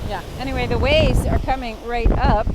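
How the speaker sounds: loud voice over the roaring wind; shouting over wind. Real, not acted. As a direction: project loudly over the wind. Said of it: "the wind is so strong here".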